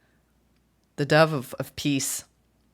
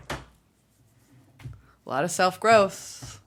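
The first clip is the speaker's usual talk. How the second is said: drawn-out musing; much slower than usual. Real, not acted. Reader musing over the card, very slow drawl. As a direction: stretch self-growth very slowly.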